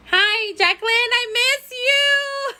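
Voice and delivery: high sweet coo. Real, not acted. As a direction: coo it up high like greeting a loved one. Greeting video call, high cooing.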